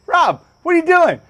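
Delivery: high, loud heckle; Kevin. Retold or imitated. Storyteller voicing his friend heckling him in the boat, tactless. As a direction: imitate a tactless friend hollering advice.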